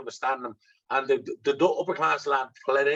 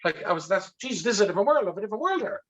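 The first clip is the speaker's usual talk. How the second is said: awestruck wonder; 8 semitones higher than usual. Retold or imitated. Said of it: "That's actually what I said".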